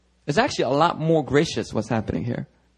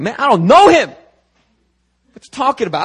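high, loud defensive outburst; acted indignant denial; 9 semitones higher, 9 dB louder than usual. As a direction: brush off the accusation loudly. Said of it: "And Peter's like".

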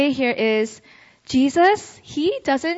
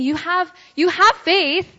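raised pitch, stressing "have faith"; emphatic reassurance; 6 semitones higher than usual. Acted. Preacher voices Jesus encouraging Peter, emphatic reassurance. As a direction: lift "have" and "faith" high to insist on it. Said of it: "He's saying".

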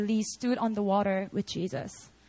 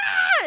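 high-pitched yell of fright; frightened scream; 6 dB louder than usual. Retold or imitated. Rider suddenly scared of crashing, high frightened scream. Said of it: "All of a sudden got so scared".

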